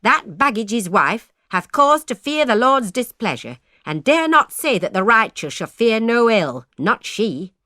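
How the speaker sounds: loud, high, righteous scolding; scolding spinster aunt. A narrator's reading. Spinster aunt rebuking her brother's wife, loud and scornful. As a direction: hurl the accusation like scripture. Agnes railing at her sister.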